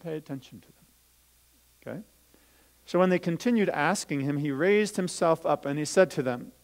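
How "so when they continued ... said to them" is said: put-on louder, lively storytelling voice for children; Bible story reading. Teacher reading the Bible story to children, lively storytelling. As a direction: read it out as a story to the kids.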